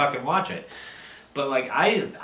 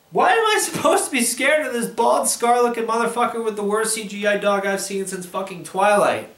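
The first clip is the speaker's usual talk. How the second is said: frustrated ranting; 6 semitones higher than usual. Real, not acted. Angry rant about the movie.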